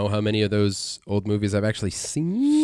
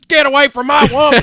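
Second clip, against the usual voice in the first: much higher, loud cowboy yell; old Western voice; 11 dB louder than usual. Retold or imitated. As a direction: bark it like a Western tough guy. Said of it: "but it's better than".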